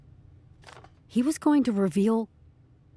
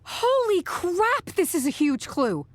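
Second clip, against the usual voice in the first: high, drawn-out amazement, then eager; thrilled, high-pitched outburst; excited discovery; 9 semitones higher, slower than usual. Acted. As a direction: exclaim over a huge find. Discovering a huge clue.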